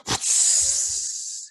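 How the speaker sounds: long voiceless imitated smoke hiss; smoke bomb hiss. Improviser vanishing from fan's question, imitated smoke-bomb hiss. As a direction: let out a long hissing puff.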